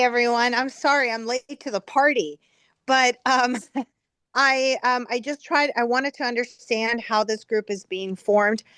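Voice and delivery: normal talking voice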